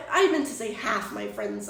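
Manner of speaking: ordinary talk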